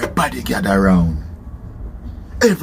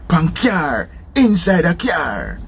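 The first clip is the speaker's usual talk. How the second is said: usual speaking voice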